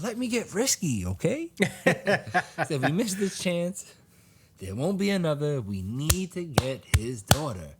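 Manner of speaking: high-pitched, hammed-up urging; mock-desperate insistence. Acts out insistent reply to friend.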